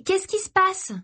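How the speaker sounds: put-on very high girlish question; insistent girl. A narrator's reading. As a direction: ask insistently in a high young voice. Girl asks again, getting up.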